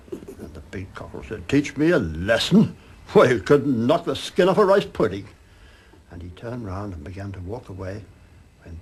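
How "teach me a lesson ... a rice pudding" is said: loud mocking scorn. A narrator's reading. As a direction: laugh him off with contempt. Big cockerel mocks the small one.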